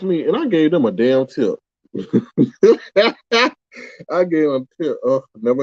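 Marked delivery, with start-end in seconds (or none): 1.9-3.5 bright burst of laughter. Real, not acted. Laughs at own tip.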